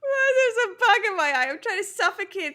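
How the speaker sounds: mocking imitation. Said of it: "It sounds so intense and unnecessary".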